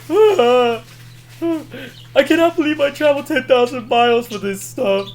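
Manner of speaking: high, loud comic complaint; mock exasperated yelling. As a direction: wail the complaint in a loud movie imitation. Acted complaint after truck scare.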